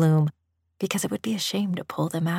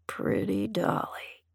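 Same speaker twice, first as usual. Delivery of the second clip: slow, soft, lilting murmur; muttered; 3 semitones higher, 5 dB quieter, slower than usual. A narrator's reading. Master admiring his dolly, muttered. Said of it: "he muttered".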